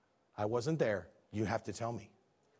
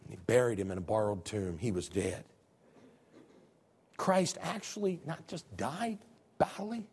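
usual speaking voice

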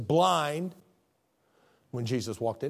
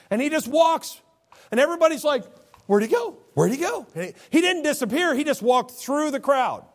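high, loud preaching voice; fiery sermon delivery; full-force preaching; 13 semitones higher, 6 dB louder than usual. Acted. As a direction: preach it out over the congregation. Preacher narrating the crowd.

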